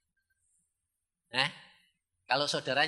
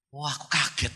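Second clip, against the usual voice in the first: ordinary talk, nothing marked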